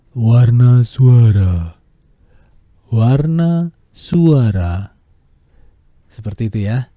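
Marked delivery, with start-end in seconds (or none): 0.1-1.8 Warna suara very loud and slow; loud slow big voice; loud voice colour. Shown as a demonstration. 2.9-5.0 Warna suara loud and drawn out; loud slow demo; louder slow take. Shown as a demonstration.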